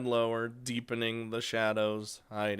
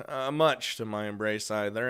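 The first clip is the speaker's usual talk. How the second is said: usual speaking voice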